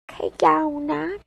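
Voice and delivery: high, drawn-out name call; squeaky mocking singsong; mock commenter. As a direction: mimic someone calling in a put-on high voice. Mimics commenter calling her name.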